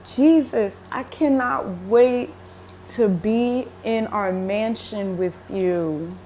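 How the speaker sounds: slow, yearning, hopeful; longing quote to Jesus. Retold or imitated. Speaker to Jesus, slow and yearning. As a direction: tell him you can't wait, slowly. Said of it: "and I was like".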